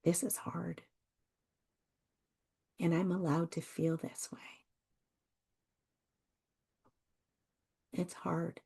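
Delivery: soft, hushed, half-whispered comfort; gentle self-talk. Shown as a demonstration. Coach showing listeners kind phrases for themselves, softly and gently. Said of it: "like these very phrases".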